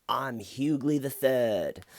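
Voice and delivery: slow, entitled preppy drawl; spoiled rich kid. Retold or imitated. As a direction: say it like a smug trust-fund kid. Imitating the suspect.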